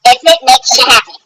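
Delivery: ordinary talk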